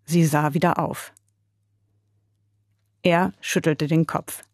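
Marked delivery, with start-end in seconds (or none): none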